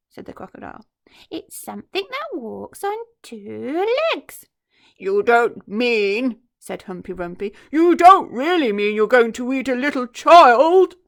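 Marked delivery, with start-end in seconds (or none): 1.3-4.5 higher, slow sly reveal; sly hint. Acted. Crocodile hinting to the hippo, slow and sly. 5.0-6.4 louder, very slow aghast voice; horrified gasp. Acted. Hippo fearing the crocodile's plan, slow and aghast. 7.7-11.0 higher, much louder appalled cry; horrified hippo. Acted. Hippo horrified at the crocodile, loud and appalled.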